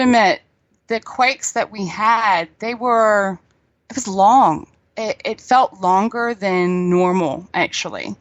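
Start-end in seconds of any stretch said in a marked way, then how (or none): none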